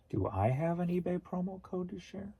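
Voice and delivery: high, soft questioning; puzzled echo. Real, not acted. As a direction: echo the question back, unsure what is meant.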